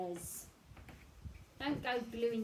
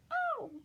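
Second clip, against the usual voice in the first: high yelp of pain; 7 dB louder than usual. Acted. Penguin pricked by pinecone.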